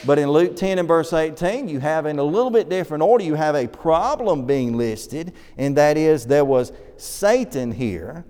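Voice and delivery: ordinary delivery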